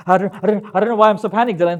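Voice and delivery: high, loud, breathless panic; panicked student. Retold or imitated. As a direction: gabble it out in a panic. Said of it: "short, sharp, panic breath".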